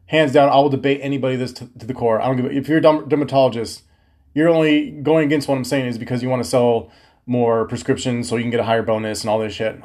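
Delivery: ordinary talk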